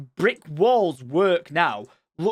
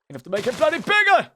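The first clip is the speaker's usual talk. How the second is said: frustrated shout; 8 semitones higher than usual. Real, not acted. Frustrated while building bridge.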